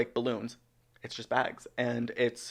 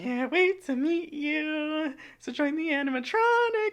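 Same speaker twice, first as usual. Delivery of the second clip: creepy quote voice; slower than usual. Retold or imitated. Quoting creepy TikTok sound.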